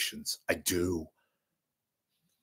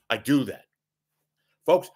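ordinary talk

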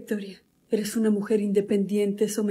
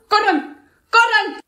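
alarmed yell; 9 semitones higher, 5 dB louder, much slower than usual. Acted. Warning everyone to flee.

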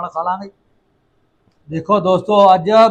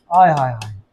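ਆਏ ਹਾਏ savouring; 6 semitones lower than usual. Real, not acted. Savouring the dish. (ਖੁਸ਼ਬੂ ਦਾ ਮਜ਼ਾ ਲੈਂਦਿਆਂ ਆਏ ਹਾਏ)

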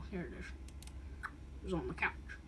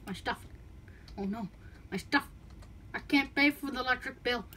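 ordinary talk, nothing marked

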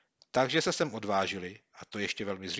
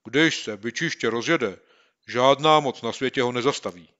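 put-on worried boy; 3 semitones higher, 11 dB louder than usual. Boy to his friend about the bully, grim. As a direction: speak with gloomy worry.

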